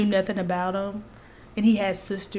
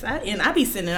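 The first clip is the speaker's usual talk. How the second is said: ordinary talk, nothing marked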